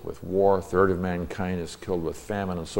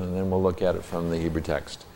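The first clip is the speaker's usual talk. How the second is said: ordinary talk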